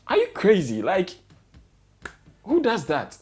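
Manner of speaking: incredulous angry rant at careless travellers; pissed off. Real, not acted. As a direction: let the frustration jump up in pitch. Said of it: "I'm sorry I'm being pissed off".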